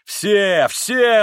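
loud, high, expansive cry; exclaiming. A narrator's reading. Mitya includes everyone in the toast, exclaiming loudly. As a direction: call it out to the whole room. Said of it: "восклицал Митя".

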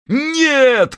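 high shouted no; chorus shout. Acted. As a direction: yell it as a chorus. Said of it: "крикнули крестоносцы любви".

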